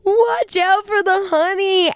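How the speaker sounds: drawn-out, high slow-motion warning. Retold or imitated. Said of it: "it is slow motion".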